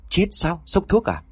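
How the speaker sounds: high shocked question; surprised. A narrator's reading. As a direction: gasp it out in surprise. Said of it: "Dung ngạc nhiên".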